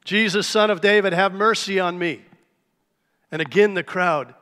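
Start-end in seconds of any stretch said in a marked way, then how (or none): 0.0-2.2 loud, high plea for mercy; louder cry. Retold or imitated. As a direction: belt it out to be heard over the crowd. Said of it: "probably louder".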